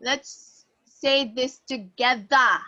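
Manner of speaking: usual speaking voice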